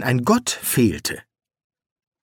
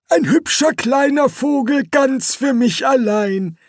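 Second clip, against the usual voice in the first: put-on much higher, sing-song old man; delighted old Quirm; 13 semitones higher than usual. A narrator's reading. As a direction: coo over the new pet. (viel höher, singend, entzückt)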